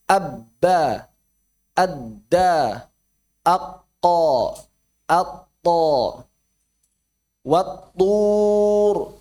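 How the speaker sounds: "wattur" stopped silently on the doubled consonant; held, cut sound. Shown as a demonstration. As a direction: block the middle of "adda" and "wattur", then release. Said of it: "tertahan suaranya".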